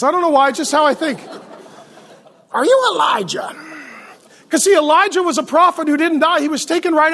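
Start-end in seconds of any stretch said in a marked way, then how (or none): 2.5-3.5 hammed-up religious busybody's question; Ned Flanders. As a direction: put on a cartoonish churchgoer voice. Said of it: "religious people sound like Ned Flanders".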